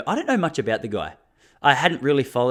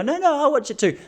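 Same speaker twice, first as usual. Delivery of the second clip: much higher, mock protesting girl; lightly voiced woman protesting; 12 semitones higher than usual. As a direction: squeak it like a girl objecting. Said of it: "girls out there who go".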